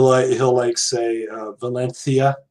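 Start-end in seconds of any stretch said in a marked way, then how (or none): 1.5-2.4 Valencia with a TH for the C; Castilian lisp. Shown as a demonstration. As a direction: say Valencia as "va-LEN-thia". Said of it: "more of the, the lisp".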